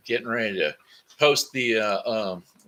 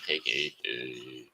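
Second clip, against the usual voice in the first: low goofy voice; 9 semitones lower, 8 dB quieter, slower than usual. Acted. Playful aside after greeting.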